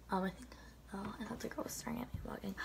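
hushed, unvoiced whisper so she won't hear; self-conscious whisper. Real, not acted. Vlogger noticing a girl staring at her filming, whispering. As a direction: drop to a whisper, embarrassed at being watched.

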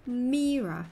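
Mira said slowly as MEE-ra; correct name pronunciation. Shown as a demonstration. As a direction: hold the "ee" in Mira. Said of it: "That's not the way".

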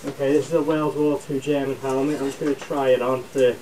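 muffled behind mask filter; speaking inside respirator; through a gas mask. Real, not acted. Seen 2: Mask with filter on face while talking; briefly leaves frame 9-11s.

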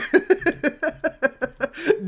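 long, high run of giggling; real delighted cackle; elated laugh. Real, not acted. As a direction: laugh helplessly with joy. Elated after all that hard work.

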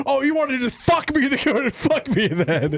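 exaggerated high scolding ref; mock angry ref. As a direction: play a ref scolding a player. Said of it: "be like".

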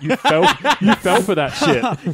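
loud, high, slow laughing jeer; gleeful taunt. Pretend. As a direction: crow over the prank while laughing. Crowing over the prank, laughing.

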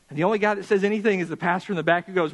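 normal talking voice

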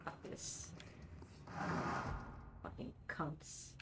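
unvoiced muttered swearing; whispered curses. Real, not acted. Gamer swearing at enemies under her breath, whispered.

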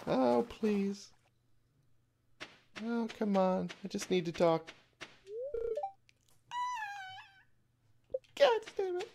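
high-pitched, whiny begging; desperate pleading. Real, not acted. Player begging to catch Demetrius in time, desperate.

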